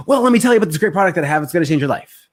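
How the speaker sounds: over-eager salesman racing through his pitch; fast hyped pitch. Shown as a demonstration. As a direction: blast a pushy sales pitch without matching. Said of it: "That was probably so fast".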